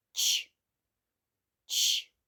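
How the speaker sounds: Ч as a strong voiceless burst, no vowel; isolated Ch sound. Shown as a demonstration. Teacher modelling the shared sound for children, isolated Ch. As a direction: say each Ч alone, pause between. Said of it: "Спільний звук який?".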